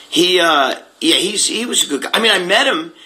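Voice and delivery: normal talking voice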